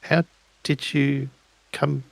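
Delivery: ordinary talk, nothing marked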